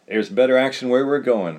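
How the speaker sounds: cowboy agreeing. A narrator's reading. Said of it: "Revel agreed".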